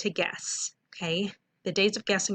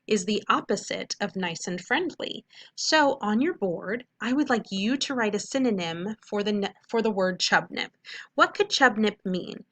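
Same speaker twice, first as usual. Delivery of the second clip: ordinary talk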